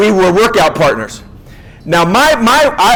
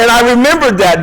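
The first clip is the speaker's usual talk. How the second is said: ordinary talk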